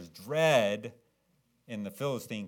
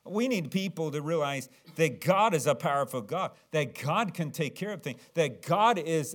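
ordinary talk

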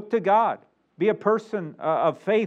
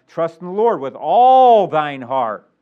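slow, loud verse with a swelling middle; forceful pulpit recitation; emphatic scripture reading; 10 dB louder, slower than usual. Acted. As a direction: belt out the key word and stretch it.

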